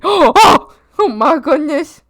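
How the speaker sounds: loud, high scandalized gasp; mock shock. Reacting to a very horny joke, mock shock. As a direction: exclaim as if scandalized.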